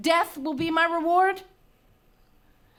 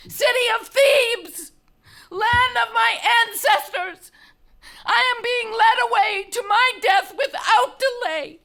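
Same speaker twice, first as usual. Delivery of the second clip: anguished outcry; 4 semitones higher, 4 dB louder than usual. Acted. Condemned woman crying out to the citizens, anguished outcry. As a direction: call the whole city to witness her wrong. Antigone cries out to the city.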